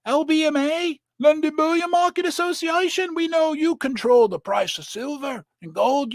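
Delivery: high, sarcastic mock voice of a trader; sneering impression of someone sucking up to the bankers; mocking quote. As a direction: play dumb and flattering, dripping with sarcasm. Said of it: "They're like".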